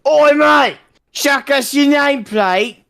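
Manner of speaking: high-pitched Cockney yell; Cockney shout. A narrator's reading. Cockney soldier calling to a pal under bombardment, shouting high. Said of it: "we heard a Cockney voice shouting".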